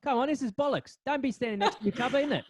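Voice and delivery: put-on a half-hearted English accent flipping between Cockney and posh; mock Cockney-posh accent. Host pretends Sharon tells shoppers to keep apart, in a mock English accent. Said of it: "dipping in and out of cock-, Cockney and, uh, posh".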